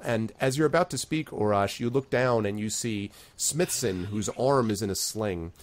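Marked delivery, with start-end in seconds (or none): none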